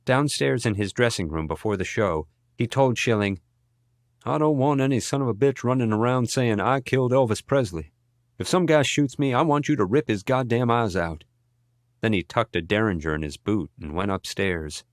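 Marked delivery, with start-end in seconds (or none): 4.2-11.3 lightly voiced [name], higher pitch; agitated [name]. A narrator's reading. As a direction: give a worked-up order, mildly acted. Scared [name] instructing his bodyguard.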